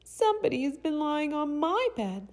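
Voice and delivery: tiny, very high little bear voice; high, loud, cute cub cry; itty-bitty Baby Bear. A narrator's reading. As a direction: squeak it out like an upset baby bear. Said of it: "in his itty-bitty voice".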